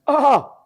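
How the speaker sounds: high, loud oh; loud mocking yelp. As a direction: blurt a weird oh. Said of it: "And I just".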